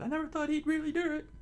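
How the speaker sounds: squeaky, quick falsetto; townspeople voice. A narrator's reading. Townspeople replying to the mayor, very high quick falsetto. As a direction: pitch it way up and rush it like a cartoon villager.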